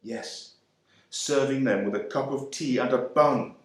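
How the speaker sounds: plain reading voice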